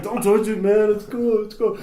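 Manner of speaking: high-pitched California burnout voice; Southern California oddball waving it off; Crazy Lance. Retold or imitated. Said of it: "He's like".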